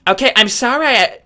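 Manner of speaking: tentative high cartoon-brat impression; Cartman. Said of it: "I don't know if I can do this one".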